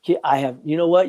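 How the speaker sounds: ordinary talk, nothing marked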